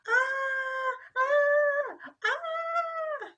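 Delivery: very high, loud yell; scared yell. Acted. Carrie yelling in fright at Sam. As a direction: scream it out. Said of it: "yelled Carrie".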